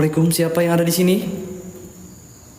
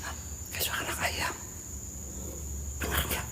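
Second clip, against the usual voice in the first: tense whisper; hushed, frightened whisper; nervous night vlog whisper; 21 dB quieter than usual. Real, not acted. Whispering about chick sounds.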